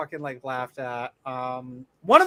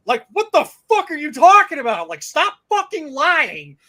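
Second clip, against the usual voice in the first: high, loud indignant outburst; raising my voice; 9 semitones higher, 8 dB louder than usual. Retold or imitated. Correcting a stranger at the game, voice raised in anger. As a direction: let the anger climb, louder and higher. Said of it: "I started raising my voice a little bit".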